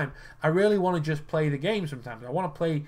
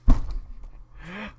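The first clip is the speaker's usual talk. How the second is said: disbelieving laugh; 4 semitones higher, 4 dB louder than usual. Real, not acted. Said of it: "reacting to the game".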